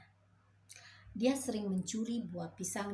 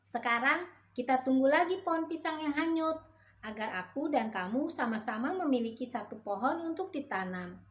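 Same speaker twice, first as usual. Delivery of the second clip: much higher, louder pushy monkey voice; the monkey urging the frog to swim, higher and louder; bossy monkey; 6 semitones higher, 5 dB louder than usual. A narrator's reading. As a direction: order your friend into the river. Monkey sets the plan.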